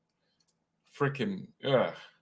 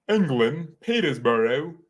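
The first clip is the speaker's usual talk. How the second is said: put-on playful English accent on a place name; 3 semitones higher, 4 dB louder, slower than usual. Streamer greeting a viewer from England, put-on English accent. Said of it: "why am I doing an accent".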